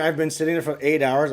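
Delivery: ordinary talk, nothing marked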